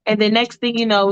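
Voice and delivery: plain reading voice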